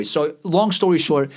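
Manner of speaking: normal talking voice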